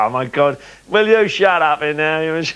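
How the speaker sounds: irritated teammate. Retold or imitated. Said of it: "he used to go".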